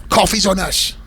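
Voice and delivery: high, eager imitation of starstruck staff; over-keen mock generosity; dial turned up. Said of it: "the dial is turned up a little bit".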